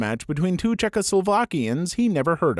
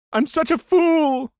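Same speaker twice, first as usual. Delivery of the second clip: high, loud, slow sobbing wail; sobbed self-reproach; 12 semitones higher, 4 dB louder, slower than usual. A narrator's reading. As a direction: cry the words out. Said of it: "Elaine sobs".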